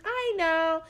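high mock voice of the coworker; hammed-up imitation of the coworker. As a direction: parrot her in a high voice. Mimicking coworker.

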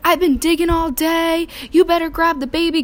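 loud, high mock dad yell; imitating dad calling out. Dad calls out after digging all day, imitated in a loud high yell. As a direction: holler it as an exasperated dad.

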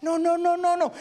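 high, insistent no; emphatic no. Real, not acted. Preacher firmly rejecting pride, pitched high. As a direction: shut the idea down.

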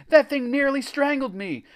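high, loud indignant protest. A narrator's reading. Greg recalls being squeezed by blob, protesting. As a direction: protest in alarm. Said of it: "Greg protested".

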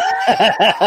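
loud real laugh; burst of laughter. Real, not acted. Laughing with friends at the cop story, loud laugh.

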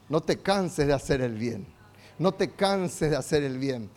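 God softly urging them not to give up; gentle voice of God. Acted. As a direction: quietly reassure a weary believer. God speaks gently to believer.

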